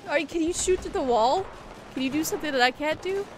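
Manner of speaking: usual speaking voice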